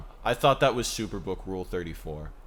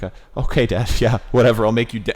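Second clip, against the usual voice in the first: put-on loud reluctant kid; 8 dB louder, faster than usual. Kid voiced in retold scene.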